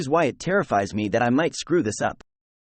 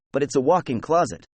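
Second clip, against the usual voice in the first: plain reading voice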